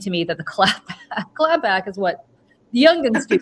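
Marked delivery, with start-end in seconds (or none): 2.7-3.4 laughing. Real, not acted. Laughing over slang.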